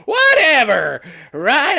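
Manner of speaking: "Whatever!" flung out high and loud; mock exasperated shout. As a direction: throw the word out like a mocking snort. Repeats the word louder.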